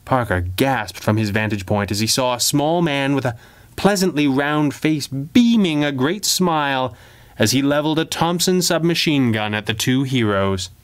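ordinary delivery, nothing marked

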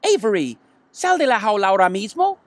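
put-on mother ordering her boy out; mother's order. A narrator's reading. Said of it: "ordenó su madre".

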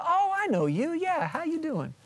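high-pitched, put-on "oh, it's you"; hammed-up high phone greeting; mock recognition. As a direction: play sudden recognition in a silly high voice. Mock recognition after wife's long introduction.

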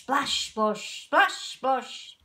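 usual speaking voice

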